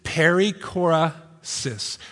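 slow, syllable by syllable Perichorasis; said really slowly. Shown as a demonstration. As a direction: stretch out "Perichorasis" slowly, each syllable clear. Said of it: "I'll say it really slowly for you".

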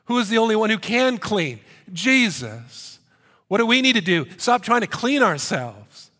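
loud rising sermon call-and-answer; preaching at full force. Real, not acted. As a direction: press the point home to the church. Preaching climax, calls and answers.

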